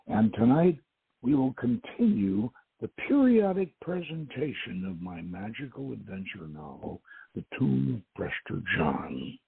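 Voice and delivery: ordinary talk, nothing marked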